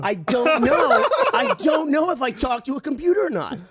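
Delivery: high, drawn-out exclamation of disbelief; baffled outburst. Real, not acted. He admits to friends he cannot tell, baffled outburst. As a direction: wail it in amused bewilderment. Exclaims his bewilderment amid laughter.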